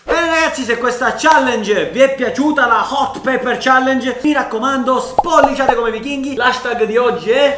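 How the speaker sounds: YouTuber outro. Acted.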